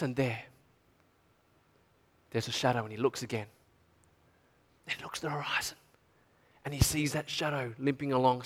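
barely holding it together; whispered, tearful wonder; real emotion, hushed. Real, not acted. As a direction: voice dropping to a choked hush. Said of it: "getting a little bit emotional".

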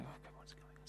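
breathy whisper under the breath; puzzled voiceless whisper; whispered aside. Retold or imitated. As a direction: whisper it so nobody hears. Nervous aside at strange scene.

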